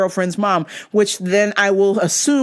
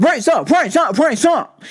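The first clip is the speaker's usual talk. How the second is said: imitating a guy calling out; punchy, rhythmic mock rap call; heard shout; 4 semitones higher than usual. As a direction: mimic a rapper's hype call. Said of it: "So we heard".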